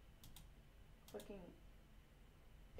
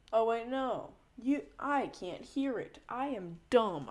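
sudden realisation; 4 semitones higher, 7 dB louder, slower than usual. Real, not acted. Realises the audio mix-up.

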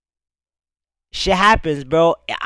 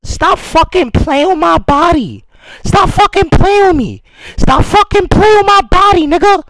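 rapped in a raised, loud voice; high voice; 7 semitones higher, 5 dB louder than usual. Acted. Rapper performing over the track in a high voice. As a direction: push the pitch up and shout the bars. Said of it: "I had to put the high voice on".

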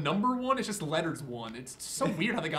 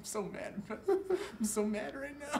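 soft, slow words through giggles; weak, laughed-out complaint; laughing exasperation; 6 semitones higher, 7 dB quieter, much slower than usual. Real, not acted. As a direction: claim fury while helplessly laughing. Claims anger while laughing.

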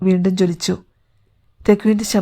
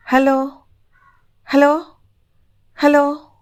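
higher-pitched hello, repeated with pauses; radio calling; 6 semitones higher, much slower than usual. Acted. Woman calls the spacecraft over the radio, calling out. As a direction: call into a mic for an answer.

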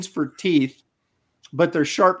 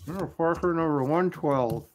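talking around a pencil held in teeth; words muffled by a pencil between teeth; pencil in mouth. Real, not acted. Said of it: "I got a pencil in my mouth".

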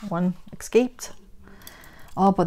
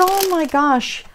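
dismayed realization; 9 semitones higher, slower than usual. Retold or imitated. Realizing her own mistake.

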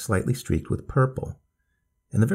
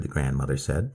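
plain reading voice

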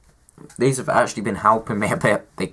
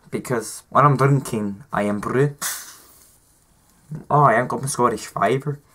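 Scottish accent; 3 semitones higher than usual. Shown as a demonstration. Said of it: "I don't know how good this accent".

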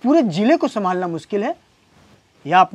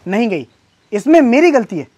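higher, louder indignant retort; indignant protest; 3 semitones higher, 5 dB louder than usual. Acted. Husband argues with wife over who is to blame, indignant protest. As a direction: throw the blame back, raised and loud.

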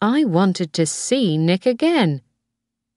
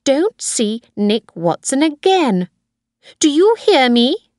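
stern higher-pitched order, rising to louder; angry; 8 semitones higher, 4 dB louder than usual. A narrator's reading. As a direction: lay down the law angrily. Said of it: "she asked angrily".

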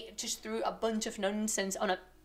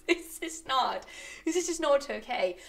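ordinary delivery

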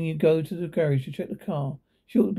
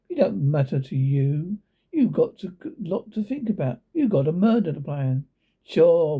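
ordinary delivery

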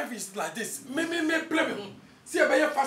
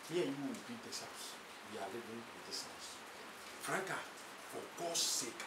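low stern threat; 8 semitones lower, 6 dB quieter than usual. Acted. Husband's low stern ultimatum.

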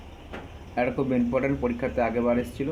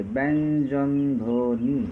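ব্যঞ্জন ধ্বনি stretched very slowly, syllables apart; much slower than usual. Shown as a demonstration. Teacher breaking the word into syllables, very slow. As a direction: count the syllables aloud slowly. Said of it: "কয়টি দল আছে?".

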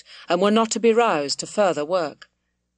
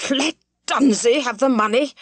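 louder, higher, rising on money in outraged disbelief; furious disbelief; 5 semitones higher, 4 dB louder than usual. A narrator's reading. Squire repeating his son's excuse, incredulous angry shout. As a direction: throw the words back at him, incredulous. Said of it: "The squire was purple with anger".

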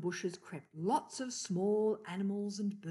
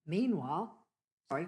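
usual speaking voice

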